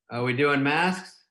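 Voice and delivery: slow, suspicious question; a driver testing the waters, drawn out; slow wary driver. Retold or imitated. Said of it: "he goes".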